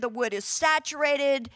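normal talking voice

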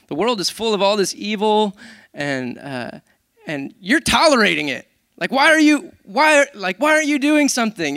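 loud, high, rising exasperated cry to God; pleading complaint. Retold or imitated. Prophet Habakkuk complaining to God, loud exasperated plea. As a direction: pour out the prophet's frustration, building. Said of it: "Habakkuk pouring out a complaint saying".

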